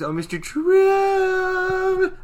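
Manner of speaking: high, loud, long-held call; singsong calling out. Retold or imitated. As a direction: call sweetly across a cave, drawn out.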